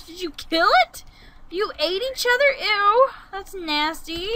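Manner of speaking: high, loud disgusted questioning; grossed-out shock. Real, not acted. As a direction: raise your voice in appalled disgust. Disgusted at creatures eating each other.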